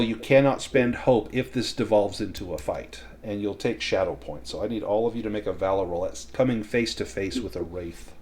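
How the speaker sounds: ordinary delivery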